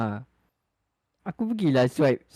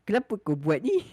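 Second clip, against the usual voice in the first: indignant; 7 semitones higher than usual. Retold or imitated. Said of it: "Tapi macam".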